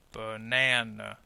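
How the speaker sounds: banana low and slow, middle syllable held; stressed middle syllable. Shown as a demonstration. Teacher putting the syllables back together, middle one stressed. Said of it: "we can call it the stressed syllable".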